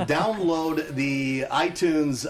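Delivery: slow, loud, deliberate "download"; "download" drawn out clearly and firmly; careful restart. Real, not acted. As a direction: enunciate "download" after a slip. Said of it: "Take my speaking course".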